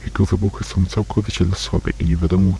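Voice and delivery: ordinary talk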